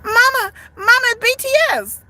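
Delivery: put-on high, excited child's squeal; little kid. A little kid spots BTS and calls to mama, high squeal. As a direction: call out to mom like a thrilled kid. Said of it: "Some little kid's gonna run up to them and be like".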